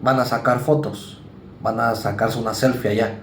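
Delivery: normal talking voice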